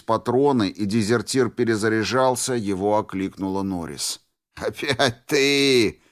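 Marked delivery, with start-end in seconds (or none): 4.6-6.0 put-on drunken laugh. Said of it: "пьяно рассмеялся он".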